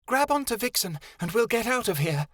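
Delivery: high, small fairy voice speaking of herself; a tiny high fairy, hissing; fairy Vixen voice. Acted.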